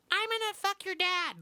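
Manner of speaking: put-on very high, squeaky kid voice; mock a shrill little gamer kid; squeaker voice. Mocking a young gamer in the lobby, very high squeak. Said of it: "They're like".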